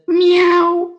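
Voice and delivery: single high kitten mew; kitten meow. A narrator's reading. Nameless kitten replying, high little mew. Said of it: "the wee cat with no name said".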